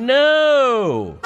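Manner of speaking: long, high wail of a no; exasperated no. Acted. Ranting at impatient drivers, exasperated. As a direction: draw it out high, then let it fall.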